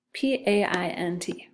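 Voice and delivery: normal talking voice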